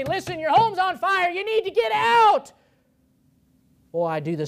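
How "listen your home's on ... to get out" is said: much higher and louder, desperate warning; urgent pleading shout. Pretend. Preacher begging listener from burning home, urgent shout. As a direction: yell like the house is burning.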